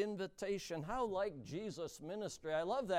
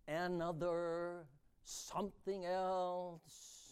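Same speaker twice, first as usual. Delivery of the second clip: very slow, quiet and drawn out; slow soft listing; 4 dB quieter, much slower than usual. Acted. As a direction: linger on each word softly. Evoking craving souls.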